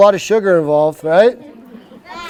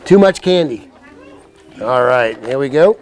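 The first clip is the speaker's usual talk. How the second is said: ordinary delivery, nothing marked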